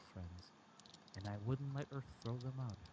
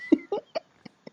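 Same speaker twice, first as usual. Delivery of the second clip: loud high giggling laugh; real helpless laughter; burst of laughter; 19 dB louder than usual. Real, not acted. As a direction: crack up, embarrassed. Reader cracks up again.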